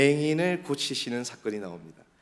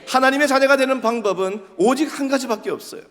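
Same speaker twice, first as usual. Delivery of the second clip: high, loud sermon proclamation; emphatic preaching; 11 semitones higher, 6 dB louder than usual. Real, not acted. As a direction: drive it home to the congregation.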